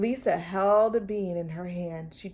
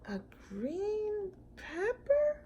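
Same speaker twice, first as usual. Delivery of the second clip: high, soft, slow puzzled question; puzzled surprise; 12 semitones higher, 9 dB quieter, slower than usual. Acted. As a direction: stretch out the confusion. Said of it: "thought Corduroy".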